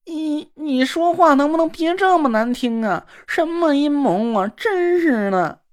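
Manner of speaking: high, mincing, huffy and slow; simpering sissy man, offended. Acted. Na Ruolan hurt by her accusation of a plot, huffy and mincing. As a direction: pout like a wounded dandy.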